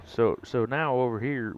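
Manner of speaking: ordinary delivery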